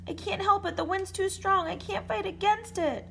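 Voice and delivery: high frantic scream. A narrator's reading. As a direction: scream it out over the storm. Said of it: "screamed the dragon".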